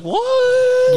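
very high, loud, stretched "what"; imitated shocked yell. Host imitates friend's reaction to the find, mock-shocked shriek. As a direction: squeal it out in mock disbelief.

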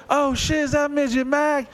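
high, excited mock squeal; excited girl. A girl recognizing Midget Mac on the street, squealing high. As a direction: gasp it like a starstruck fan.

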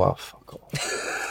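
a hushed, dismissive aside under the breath; muttered brush-off. Retold or imitated. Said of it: "you're just walking away and you go".

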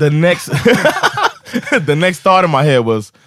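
plain reading voice

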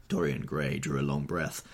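ordinary delivery, nothing marked

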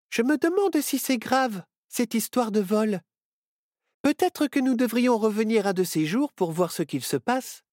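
put-on high, wondering girl voice. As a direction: muse aloud like a curious girl. Myrtille wonders aloud.

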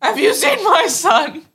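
high, slow, loud desperate cry; screaming parent. Retold or imitated. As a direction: yell it like a frantic parent. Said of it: "Screaming parent".

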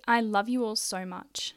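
ordinary talk

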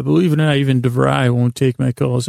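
usual speaking voice

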